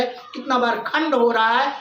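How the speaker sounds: ordinary delivery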